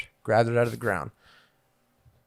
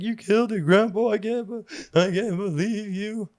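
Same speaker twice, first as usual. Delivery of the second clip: put-on upset crying kid; 8 semitones higher than usual. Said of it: "And I remember I cried".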